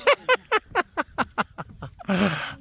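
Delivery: high, real burst of laughter; long giggle. Real, not acted. After a run-in with a puppy, long high giggle. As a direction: giggle helplessly for a few seconds.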